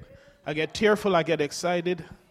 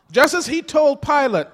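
rising fervor; 6 semitones higher, 7 dB louder than usual. Real, not acted. Preacher builds to a climax.

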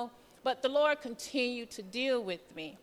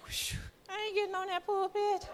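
mock relief; 8 semitones higher than usual. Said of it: "I'm like".

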